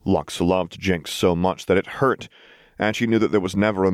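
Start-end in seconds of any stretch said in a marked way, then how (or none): none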